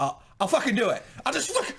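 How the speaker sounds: laughing, pitch jumping way up; laughing outburst. Real, not acted. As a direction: blurt it out through laughter.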